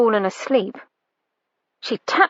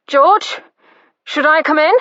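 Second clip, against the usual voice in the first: louder and higher call through a door; 6 semitones higher, 5 dB louder than usual. A narrator's reading. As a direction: call out to someone inside. Brother calling through door.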